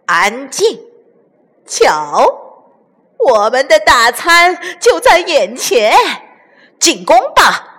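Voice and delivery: gloating villain swelling to a loud war cry; weird-voiced bandit chief. A narrator's reading. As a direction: command your gang, relishing the feast. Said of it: "怪声笑道".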